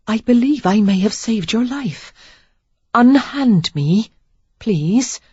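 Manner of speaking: polite but firm, drawn out plea; prim young lady pleading. A narrator's reading. Sarah demands release from grip.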